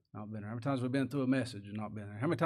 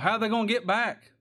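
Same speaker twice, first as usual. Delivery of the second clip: fervent preaching; 8 semitones higher, 7 dB louder than usual. Acted. Preacher's rising rhetorical question.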